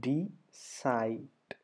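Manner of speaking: DECIDE split slowly into two drawn-out syllables. Shown as a demonstration. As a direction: say "Decide" as dee... side, with a clear gap. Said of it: "രണ്ട് syllables വരണം".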